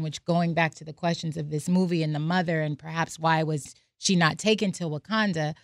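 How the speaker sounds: ordinary delivery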